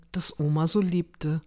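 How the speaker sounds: ordinary delivery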